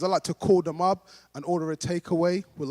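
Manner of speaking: usual speaking voice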